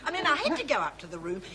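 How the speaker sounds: usual speaking voice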